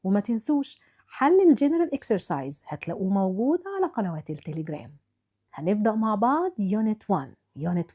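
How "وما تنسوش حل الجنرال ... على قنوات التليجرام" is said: ordinary delivery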